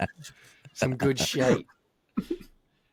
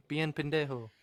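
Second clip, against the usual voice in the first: quoting Penta; 3 dB quieter than usual. Retold or imitated. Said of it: "That's what Penta says".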